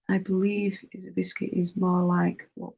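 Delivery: normal talking voice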